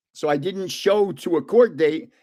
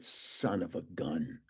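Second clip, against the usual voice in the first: much lower and softer, slow resigned mutter; 11 semitones lower, 15 dB quieter, slower than usual. Retold or imitated. Realizing the warrant was a parking ticket, slow low mutter. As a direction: let it sink under your breath.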